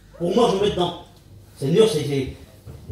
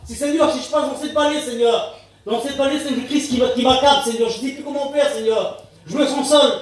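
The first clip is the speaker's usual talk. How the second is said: higher-pitched plea to the Lord; pleading prayer; 6 semitones higher than usual. Acted. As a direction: pray aloud as a believer at a loss. Voices a struggling believer's prayer. (prière suppliante, voix plus haute)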